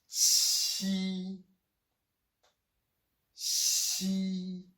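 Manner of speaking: qi with the tone going up; rising qi. Shown as a demonstration. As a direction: lift the tone on qi each time. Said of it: "your tone is going up".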